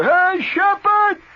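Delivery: loud high call across the back yard; hollering. Retold or imitated. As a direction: yell his name out from the back. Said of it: "They holler in the back".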